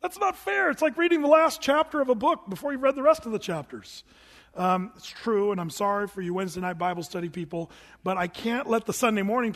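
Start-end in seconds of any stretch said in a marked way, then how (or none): 0.0-4.0 much higher, complaining voice; whiny protesting listener. Retold or imitated. Wednesday night listener protesting to Pastor [name], high whine. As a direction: whine that it isn't fair. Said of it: "some of you Wednesday nighters are like".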